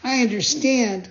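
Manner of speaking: a hint of weary puppet voice; high, lightly weary; Pinocchio voice. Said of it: "said Pinocchio at once wearily".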